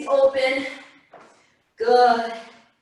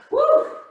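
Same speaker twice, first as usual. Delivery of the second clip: excited whoop; 4 dB louder than usual. Real, not acted. Cheering mid workout.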